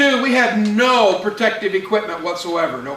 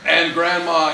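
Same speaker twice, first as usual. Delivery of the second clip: normal talking voice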